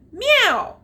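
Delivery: high, loud mock meow; playful meow in a read-aloud. A narrator's reading. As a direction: squeal a cartoon meow. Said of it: "added the dog".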